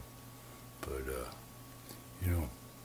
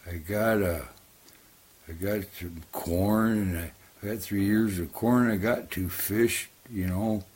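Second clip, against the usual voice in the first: raccoon voice; 5 semitones higher, 8 dB louder than usual. Acted. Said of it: "the raccoon goes".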